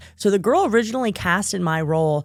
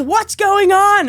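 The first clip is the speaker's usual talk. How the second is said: worried dad; 10 semitones higher, 4 dB louder, slower than usual. Retold or imitated. Dad asking in alarm.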